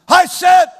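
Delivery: sharp loud parental yell; angry yell. Shown as a demonstration. Parent yelling at a child to obey, loud yell. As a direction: snap it out hard and loud. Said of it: "it should not be".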